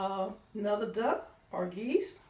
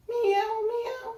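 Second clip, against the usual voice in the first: much higher squeaky meow; meowing cat; 13 semitones higher, 5 dB louder than usual. Acted. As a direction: mew like a little cat. Cat sound in story.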